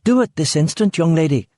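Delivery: high, sharp mother's bark; barked order. A narrator's reading. Rachel scolding Claire in front of Eric, high sharp bark. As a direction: bark it at a defiant daughter. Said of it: "Rachel barked".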